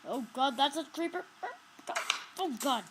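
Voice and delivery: high, loud alarmed cry; frightened. Real, not acted. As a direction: yelp as the creeper appears. Spots creeper.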